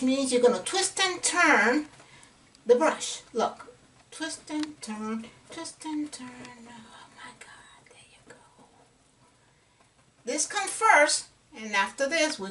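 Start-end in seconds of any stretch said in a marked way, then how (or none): 4.1-6.3 soft, slowed-down brush-side murmur; hushed. Real, not acted. Guiding viewers through twist and turn, hushed. 6.7-8.4 barely-there whisper. Real, not acted. Painter absorbed in a delicate brush stroke, whispering. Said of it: "I'm whispering because it helps me go light".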